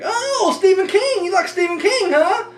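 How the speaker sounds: high, gushing mock of a chatty coworker. Mimicking a coworker bothering him on break, high and gushing. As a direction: parody the pushy fan in a high voice. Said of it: "they're like".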